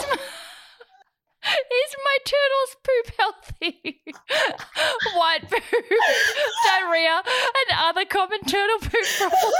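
high, squeaky reading through giggles; real helpless laughter breaking up the words; can't say it for laughing. Real, not acted. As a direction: read it out while falling apart laughing. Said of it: "I couldn't even say that out loud".

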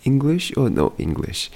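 high-pitched mock customs officer question; condescending customs lady. Customs lady to presumed non-English speaker, high and condescending. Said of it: "treated me as if I spoke zero English".

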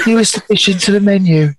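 high-pitched put-on voice, breaking into laughter; Mister Cray. Co-host redoing the menu line on request, high-pitched voice. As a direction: read the menu line high, amused by your own impression. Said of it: "Mister Cray would do it in a high pitched voice".

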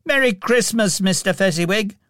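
quick, high-pitched cheerful call; young man calling back. A narrator's reading. Young Scrooge toasts his employer, calling back. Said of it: "calling back".

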